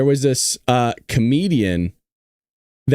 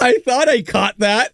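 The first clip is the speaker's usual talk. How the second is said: laughing imitation; 9 semitones higher, slower than usual.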